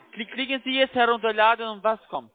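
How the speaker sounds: ordinary delivery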